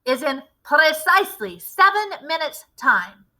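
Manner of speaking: a lightly voiced commanding witch leader; a hint of a grand witch showing off her recipe; Grand High Witch. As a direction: lecture the coven like their boss.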